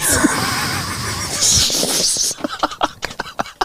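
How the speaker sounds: high, soft wheezing laugh; real laugh fading out of breath. Real, not acted. Laughing at the German tourist joke, high wheezy laugh.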